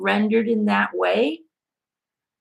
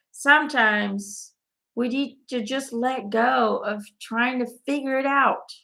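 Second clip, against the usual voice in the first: normal talking voice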